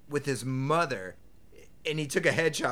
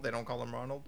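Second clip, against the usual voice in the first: ordinary talk, nothing marked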